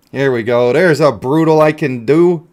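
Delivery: normal talking voice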